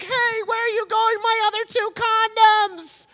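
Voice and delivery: very high-pitched squealing mock yell; retold yell. Teen yelling after man with his condoms, squeaky yell. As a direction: call after him in a shrieking falsetto. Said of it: "I yelled at him".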